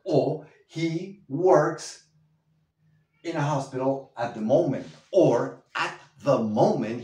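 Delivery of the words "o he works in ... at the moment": he works drawn out very slowly, louder; slow demo. Shown as a demonstration. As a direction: spell it out word by word. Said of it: "he currently works in a hospital".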